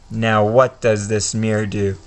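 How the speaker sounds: usual speaking voice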